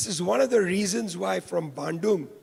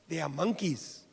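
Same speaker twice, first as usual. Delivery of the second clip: usual speaking voice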